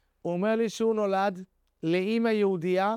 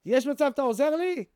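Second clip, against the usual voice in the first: raised, higher call across the yard; friendly retold shout from far off; calling out from afar; 7 semitones higher than usual. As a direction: call out to him from a distance. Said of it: "ומרחוק אני צועק לו".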